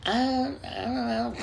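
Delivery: a hint of a sheepish kid. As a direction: mumble it like a caught little boy. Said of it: "And I was like".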